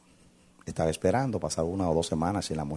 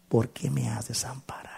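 whispered lament; 6 semitones higher, 4 dB quieter than usual. Acted. Jesus on the cross quote.

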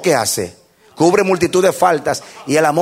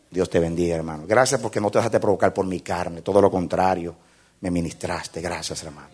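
a lightly voiced humbled brother, softer and lower; quiet thankful apology; understated repentant thanks; grateful brother; 6 semitones lower, 4 dB quieter than usual. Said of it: "va a decir".

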